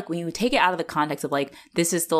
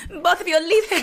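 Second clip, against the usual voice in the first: high mock-stage command; theatrical stage voice; 12 semitones higher, 3 dB louder than usual. Co-host mimicking Rick's mother, theatrical stage voice. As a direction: overact a grand, scolding mother. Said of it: "It sounds like a stage voice".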